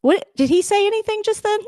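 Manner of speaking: sudden high startled question; surprised. Real, not acted. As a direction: jump up in surprise. Startled by a sound in the clip.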